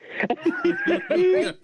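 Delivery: squealing laugh burst; high amused laugh. Real, not acted. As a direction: let out a high giggle. Laughing at cop remark.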